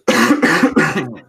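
hard cough; coughing fit. Real, not acted. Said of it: "désolé, je tousse".

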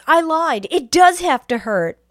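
high, loud gloating villain; mock villain menace. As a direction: act out a hammed-up monster confession. Acted villain turning on victim.

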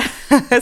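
short nervous giggle; nervous laugh. Real, not acted. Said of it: "c'est un rire nerveux".

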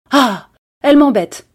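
loud, high exasperated complaint; exasperated outburst. Acted. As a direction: blurt out your irritation. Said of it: "cette forcenée".

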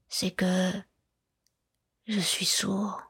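hushed confession trailing off; hesitant. A narrator's reading. Hunchback admitting his deafness to the girl, hushed. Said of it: "comme hésitant à achever".

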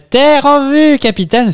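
high, slow, loud call from the crow's nest; lookout shout. Pretend. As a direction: bellow it out like a ship's lookout. Sailor calling out land sighted.

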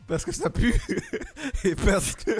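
laughing answers. Real, not acted. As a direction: giggle through the list. (en riant)

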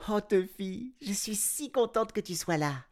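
put-on high, delighted girl's voice; happy girl. A narrator's reading. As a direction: welcome your cat home. Girl greets cat.

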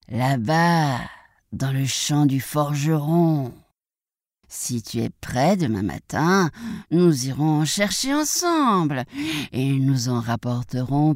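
unhurried, drawn-out wolf voice; coaxing wolf. Acted. Wolf invites the piglet out next morning, slow and coaxing. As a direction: lure the prey along slowly. Wolf plans outing with the pig.

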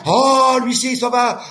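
very high shout of alarm; alarmed cry. Retold or imitated. Swimmer crying out in alarm as the wave throws him, very high.

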